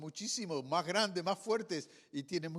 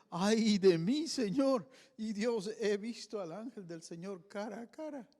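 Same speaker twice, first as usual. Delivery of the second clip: miedo; 8 semitones higher, 5 dB louder than usual. Acted. Gedeón ve al ángel, teme morir.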